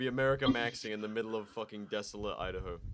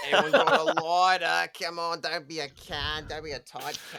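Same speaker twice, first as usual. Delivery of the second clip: broad Australian accent, hammed up, louder at the start; mock Australian accent; 3 semitones higher, 7 dB louder than usual. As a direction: do Al as a loud, pushy Australian bumming a smoke. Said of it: "if he says in that accent".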